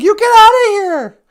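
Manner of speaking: loud, high get-out shout; angry yell. Retold or imitated. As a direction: bark the order to leave. Angry rider chasing man off.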